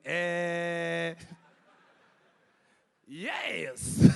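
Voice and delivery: loud drawn-out eh then yes; confident kid's eh. Retold or imitated. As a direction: stall with total confidence. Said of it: "I went".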